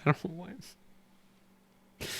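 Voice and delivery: real tearful choke, high, soft and slow; choked up with emotion. Real, not acted. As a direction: fight back tears mid-reading. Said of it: "why I'm getting emotional".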